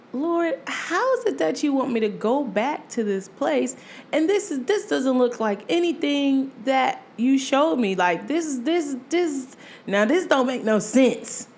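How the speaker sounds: high, pleading complaint to the Lord; a touch of retold anger; upset protest. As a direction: argue with God, exasperated. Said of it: "I was mad".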